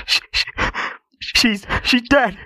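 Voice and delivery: very high, slow broken sob; grief-stricken father, high and halting; wailing. A narrator's reading. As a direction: wail it out in stuttering gasps. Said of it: "he wailed on".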